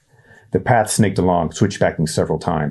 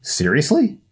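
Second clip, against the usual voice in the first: high, incredulous scoff; mock his friend's surprise; boy scoffing at a naive remark; 10 semitones higher than usual. A narrator's reading. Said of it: "Ryan scoffed".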